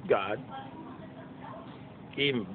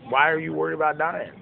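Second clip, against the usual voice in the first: ordinary talk, nothing marked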